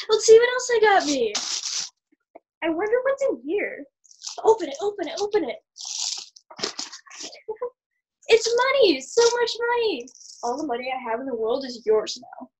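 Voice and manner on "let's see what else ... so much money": high, eager excitement; excited. Acted. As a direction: tear into presents gleefully. Excited opening gifts.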